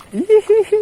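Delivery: high, loud tittering giggle. Real, not acted. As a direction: giggle with glee. Giggling at catch.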